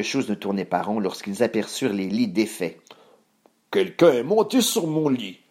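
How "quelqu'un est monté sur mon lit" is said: big put-on bear voice, rising in pitch; big Papa Bear voice. A narrator's reading. Papa Ours seeing his bed undone, big voice. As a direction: boom it as father bear. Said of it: "d'une grosse voix".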